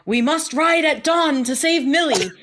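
heroic rallying cry, pitched high; hammed-up dramatic proclamation; Enzo. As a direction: declaim it like a mock-epic hero. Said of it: "he essentially tells Nina".

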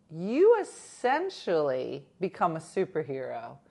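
ordinary talk

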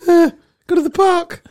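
put-on very high, giggly, timid boy voice; nervous schoolboy. Schoolboy answering the girl's dad, high and giggling. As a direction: squeak it out, laughing nervously.

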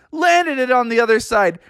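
ordinary delivery, nothing marked

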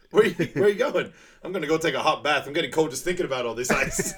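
high, loud, quick quote with a laugh; acted movie quote. Quoting Sanka walking off from the ice talk, high hurried protest. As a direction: rattle off the comic exit line. Acting the film's exchange.

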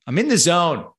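heated and loud, fed up; angry. Real, not acted. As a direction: let the frustration burst out. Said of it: "I'm angry".

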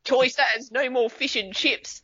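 high-pitched, drawn-out New Zealand accent; Kiwi accent. Shown as a demonstration. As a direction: put on a high, slow Kiwi news voice. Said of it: "Give us a, give us a Kiwi accent".

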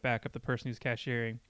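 usual speaking voice

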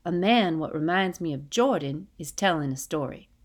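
ordinary delivery, nothing marked

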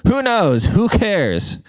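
high, tossed-off dismissal; mock-exasperated dismissal. Real, not acted. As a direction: brush it off in a high-pitched voice. Dismisses his own wording question.

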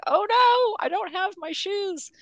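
sudden high alarmed yelp; panicked surprise. Retold or imitated. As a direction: gasp it out, shoes forgotten. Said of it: "and I'm like".

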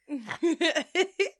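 rising giggle; high laugh. Real, not acted. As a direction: break into laughter. Laughing at own story.

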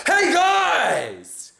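overexcited stage shout, slow on the last line; hyped rock shout. Retold or imitated. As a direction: shout it like a rock frontman to a dead room. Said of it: "you walk on just being like".

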